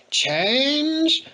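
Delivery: lightly voiced mumbling drunk; mumbled drunk. A narrator's reading. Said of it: "mumbled the old drunk".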